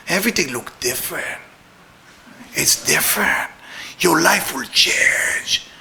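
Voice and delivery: hushed, near-whispered promise; mock-mystical hush; secretive revelation. Acts out man revealing pill's secret.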